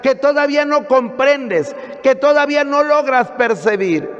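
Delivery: emphatic sermon in long, insistent phrases; preaching. Acted. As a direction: drive it home to the congregation. Preaches to a congregation.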